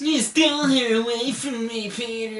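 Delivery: mocking high-pitched whiny brat; whiny, petulant impression; Harry. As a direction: echo the cartoon whine, high and strained. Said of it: "That's how he talks".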